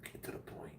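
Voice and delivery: whispered aside; whispering. Real, not acted. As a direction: hiss it under your breath. Irritated aside under breath.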